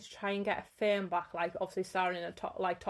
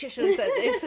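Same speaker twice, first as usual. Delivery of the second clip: higher and louder, laughing through it; mock angry outburst; 11 semitones higher, 7 dB louder than usual. Voicing the comeback she wished she'd said: laughing mock shout. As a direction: blurt it like an imagined row boiling over. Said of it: "have a full fight in my head".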